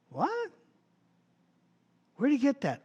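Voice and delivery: a high, astonished "What?"; surprised. Real, not acted. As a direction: sound genuinely taken aback. Preacher reacts to centurion's words.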